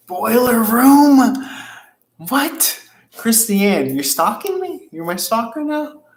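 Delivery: high-pitched, loud disbelief; astonished. Real, not acted. Host amazed a viewer guessed a favorite movie, astonished. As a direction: blurt it out in rising, amazed questions.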